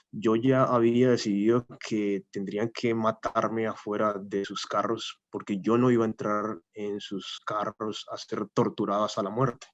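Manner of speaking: usual speaking voice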